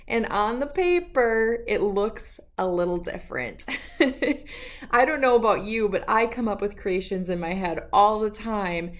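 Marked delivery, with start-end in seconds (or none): 3.7-4.9 light laugh. Real, not acted. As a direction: chuckle at her own remark. Teacher laughs before story.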